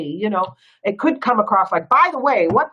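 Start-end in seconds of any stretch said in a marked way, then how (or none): 1.9-2.7 a touch of irritation on "By the way, what"; "By the way, what" said a bit louder and curt; mock angry. Shown as a demonstration. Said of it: "could come across as angry".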